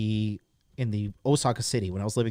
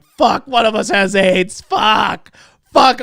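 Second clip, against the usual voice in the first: loud, high retold outburst; hammed-up frantic yelling; panicked cursing; 8 semitones higher, 9 dB louder, slower than usual. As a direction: shout the curses in mock desperation. Retold panic entering the clinic door.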